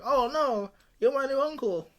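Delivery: mock-dismayed, muddled Italian-style accent; put-on Italian-sounding accent. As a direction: wail it in a hammed-up Italian-ish accent. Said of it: "Why did you just do, like, a accent?".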